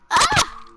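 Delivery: short, very high "ah!"; startled shriek. Real, not acted. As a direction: yelp suddenly, pitch leaping up. Sudden scare in the game.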